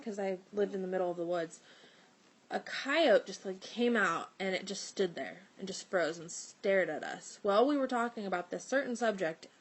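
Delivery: ordinary talk, nothing marked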